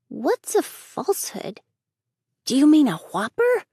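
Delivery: put-on high, loud little boy, pleased with himself; a cheeky boy grinning at his prank; boy's voice. Davy's childish voice.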